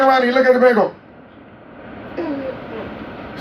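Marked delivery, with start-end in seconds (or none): none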